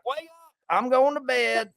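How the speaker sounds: mock drunk announcing bedtime; drunk buddy. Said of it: "And you're like".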